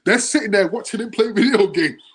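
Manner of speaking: can't hold back the laugh as he tells it. Real, not acted. Laughing while telling funny story.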